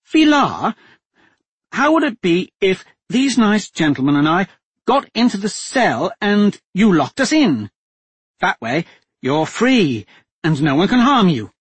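a hostage duchess coolly taking charge; steady duchess voice. A narrator's reading. Captive duchess telling the men to make a deal, steady and firm.

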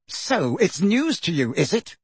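angry, disbelieving challenge; thick with anger. A narrator's reading. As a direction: draw it out with contained fury. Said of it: "his voice now thick with anger".

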